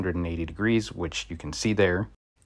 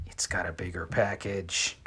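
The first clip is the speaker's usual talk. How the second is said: teasing near-whisper, much softer; hushed aside; 3 semitones lower, 9 dB quieter than usual. Pretend. Reviewer teasing Milwaukee fans about the Klein, hushed aside. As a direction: drop to a sly whisper, as if sharing a secret.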